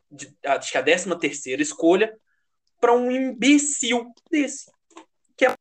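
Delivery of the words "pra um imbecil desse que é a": contemptuous. Real, not acted. Ranting about wasted draft pick. (desprezo irritado)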